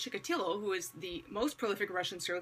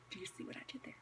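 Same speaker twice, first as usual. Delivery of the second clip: barely audible whispered repeat; hushed aside; 2 semitones lower, 15 dB quieter, much faster than usual. Real, not acted. Preacher nudging listeners about his clever question, whispered.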